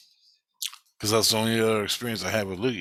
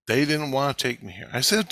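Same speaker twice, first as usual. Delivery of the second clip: ordinary delivery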